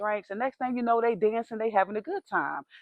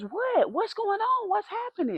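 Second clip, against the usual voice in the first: high, anxious what's going on; friend's worried high questions; alarmed questions; 11 semitones higher than usual. Retold or imitated. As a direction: ask in a frightened rush.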